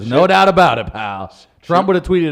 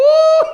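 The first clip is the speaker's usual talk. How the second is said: whooping high laugh; 10 dB louder than usual. Real, not acted.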